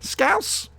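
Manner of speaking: Scouse? pitched high with a rising tune; a high incredulous echo of Scouse; surprised query. Real, not acted. Host echoing the accent suggestion in disbelief.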